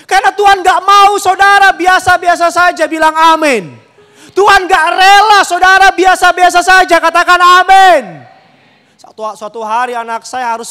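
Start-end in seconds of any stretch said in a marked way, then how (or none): 0.0-8.2 high, loud, driving sermon delivery; forceful pulpit proclamation; preaching at full force. Real, not acted. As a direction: preach it out to the congregation, building to a loud amen.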